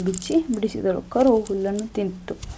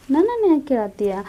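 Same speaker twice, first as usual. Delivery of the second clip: higher, louder, fast indignant protest; quick, raised complaint from the baby; indignant child; 6 semitones higher, 4 dB louder, faster than usual. Acted. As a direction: fire it back as a fed-up child.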